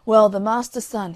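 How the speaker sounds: ordinary delivery, nothing marked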